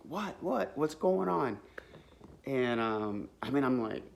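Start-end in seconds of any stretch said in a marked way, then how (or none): 0.1-1.6 higher, alarmed what what; a sudden scare, asking what is wrong; startled. Retold or imitated. Speaker startled by her friend's screaming in the security line, higher alarmed question. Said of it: "And I'm like".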